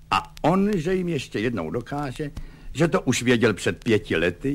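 ordinary delivery, nothing marked

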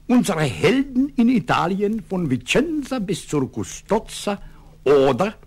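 high, pompous German essay title; solemn declamation. A narrator's reading. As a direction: announce the German title with grand ceremony. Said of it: "poručík Dub slavnostně dodal".

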